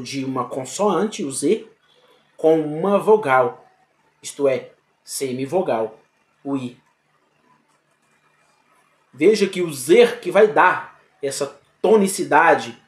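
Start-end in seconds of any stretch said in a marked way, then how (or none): none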